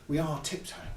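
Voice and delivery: quiet sneaky chant; tiptoeing hush. Shown as a demonstration. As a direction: whisper it like tiptoeing spies. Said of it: "Maybe it's like".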